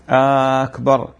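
"أكبر" with a long stretched first vowel, slow; slow stretched أكبر as a question; drawn-out akbar. Shown as a demonstration. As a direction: stretch أكبر's opening vowel slowly. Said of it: "لأنه فيه استفهام".

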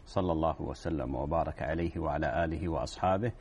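solemn preacher's opening formula; formal opening invocation. Acted. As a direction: recite the opening blessings calmly and evenly. Formal opening praise and greeting.

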